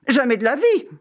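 the cat cries out in protest; cat's cry. A narrator's reading. Cat refusing to cross the river, crying out high. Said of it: "cria le chat".

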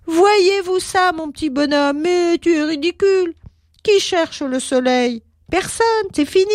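mocking cloud.

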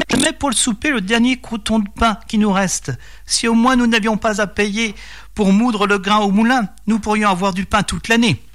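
high-pitched voice for a peasant wife; plaintive farmer's wife. A narrator's reading. Wife to husband over their last bread, plaintive high voice.

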